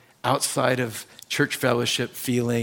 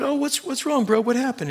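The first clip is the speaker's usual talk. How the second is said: mock concerned; 11 semitones higher than usual. Pastor voices his own concern.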